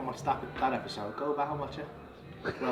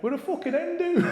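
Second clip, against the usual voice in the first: very high-pitched mock party girl; rowdy hen party; 12 semitones higher than usual. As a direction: imitate an excited hen party. Hen party announces itself.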